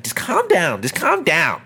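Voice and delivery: mock pleading teacher. Teacher trying to settle an angry student, mock pleading. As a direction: imitate a teacher helplessly appealing for calm.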